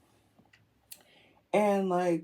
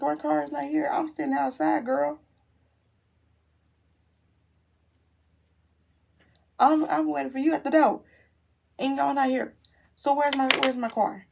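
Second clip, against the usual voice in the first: higher-pitched indignant complaint; exasperated; 6 semitones higher, 4 dB louder than usual. Retold or imitated. Car owner disputes the arrival message, exasperated. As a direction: plead your case, fed up.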